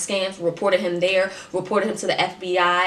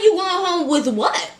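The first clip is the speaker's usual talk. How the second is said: pitched way up in disbelief, slowed; incredulous retort; 11 semitones higher, 3 dB louder than usual. Retold or imitated. To the scammer who says he's going home, high incredulous disbelief. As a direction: scoff at the absurd claim, high and drawn out.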